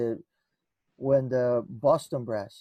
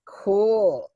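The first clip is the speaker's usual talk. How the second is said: high, loud stunned "Cool."; stunned friend; 8 semitones higher, 7 dB louder than usual. Retold or imitated. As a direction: say "Cool." as if frozen in shock. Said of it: "He was like".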